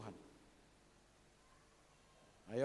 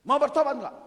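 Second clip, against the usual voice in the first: pointed challenge; 8 semitones higher, 12 dB louder than usual. Acted. Acted pastor challenging sinner.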